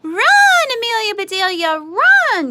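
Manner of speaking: very high, loud urgent yell. A narrator's reading. Boys yelling at Amelia Bedelia to run, very high and loud. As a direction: holler her on to run. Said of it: "yelled the boys".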